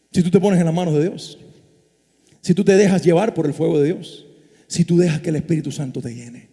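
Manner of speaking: predicación mucho más suave, casi confidencial; prédica íntima. Acted. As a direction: baja la voz y predica de cerca a la congregación. Prédica íntima en voz baja. (predicador que baja la voz para suplicar con intensidad)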